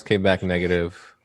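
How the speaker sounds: ordinary talk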